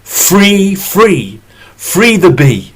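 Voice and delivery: loud, high rallying chant; neighbours crying out. Acted. Neighbours breaking the glass and chanting loudly to free the bee. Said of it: "And the neighbours called".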